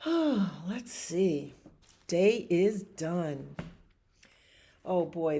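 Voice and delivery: plain reading voice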